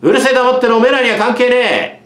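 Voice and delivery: put-on bully yelling at the girls; shouting. A narrator's reading. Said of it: "大声を張り上げた".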